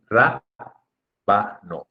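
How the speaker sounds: "rábano" spaced as RÁ... ba... no; slow syllables in "rábano", weight on the first; stressed first syllable. Shown as a demonstration. Teacher showing why it carries a tilde, "rábano" stressed on RÁ. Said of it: "por eso se dice".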